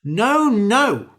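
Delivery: high, loud cry of alarm. A narrator's reading. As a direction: shout it in a panic. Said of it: "cried the man".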